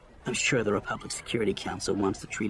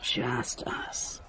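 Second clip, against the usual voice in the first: breath-only, hushed echo; whispered; 3 dB quieter than usual. A narrator's reading. He repeats it against her cheek, whispered. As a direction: whisper it close to the ear.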